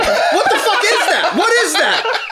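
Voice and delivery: high, slow, laughing incredulity; mock appalled disbelief. Friend reacts to milk from the nose, mock appalled. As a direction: demand it way up high, half laughing. Acting Bryce's shock at the milk.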